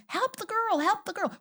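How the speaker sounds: high mock alarm; mock panic. Imagined alien onlooker, high mock panic. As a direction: plead it like a frightened alien. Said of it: "all you're thinking is".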